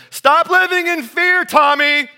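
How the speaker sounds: high, loud, slow scolding yell from the bedroom; mock parent yell. Parent yelling at scared child from bed, loud mock yell. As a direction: bark it like a lazy parent from bed. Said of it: "yell from your room".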